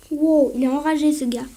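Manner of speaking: usual speaking voice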